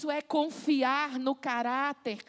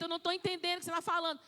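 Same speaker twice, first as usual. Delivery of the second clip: very fast, softer grumbling; exasperated parent; 4 semitones higher, 4 dB quieter, much faster than usual. Acted. Parent unable to understand the crying child, exasperated and hurried. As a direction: rattle it off in impatience. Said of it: "aí você fala pra ela assim".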